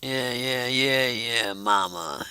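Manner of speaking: deep smooth man voice. Retold or imitated. Imitating Wes's deep voice calling her mama. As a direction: drop way down and croon it like a smooth talker. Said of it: "I think he went to his deep".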